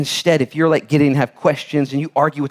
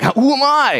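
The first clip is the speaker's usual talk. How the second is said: whiny Gideon; 12 semitones higher, 3 dB louder, slower than usual. Acted. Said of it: "Gideon's like".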